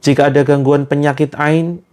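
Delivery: slow, measured prayer leader's chant; devout prayer recitation. Acted. Healer leading online listeners in ruqyah prayer, slow devout recitation. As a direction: recite each name of God slowly and earnestly.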